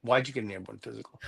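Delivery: a lightly voiced doubting recruiter, muttered low; skeptical recruiter. Recruiter questioning the priest's airborne physical, low and quick. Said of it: "he looks at me and goes".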